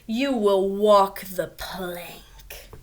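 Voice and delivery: slow, low whispered threat; menacing whisper. Acted. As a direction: breathe the threat out slowly. Pirate captain threatens captives.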